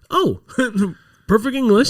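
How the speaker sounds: high-pitched, eager mock missionary; mock delighted surprise. Missionaries switch to English on a tourist, mock delight. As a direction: pitch leaps up in imitated delight.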